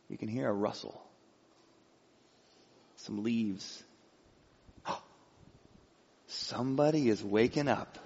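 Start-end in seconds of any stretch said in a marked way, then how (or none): none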